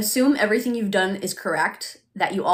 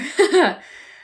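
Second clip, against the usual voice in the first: high laugh; 10 semitones higher, 8 dB louder than usual. Real, not acted. Laughing at own joke.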